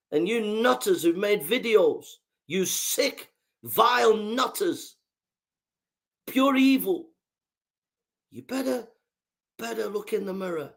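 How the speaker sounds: angry denunciation. Real, not acted. Speaker condemns nutters who made videos, angrily. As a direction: spit out the insults, pitched high. Denouncing those who mocked a death.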